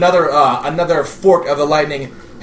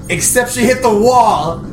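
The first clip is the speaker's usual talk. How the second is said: higher-pitched, building to the impact; dramatic narration; 7 semitones higher, 4 dB louder than usual. A narrator's reading. Game master describing a griffin's fatal fall, dramatic. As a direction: punch up the crash for suspense.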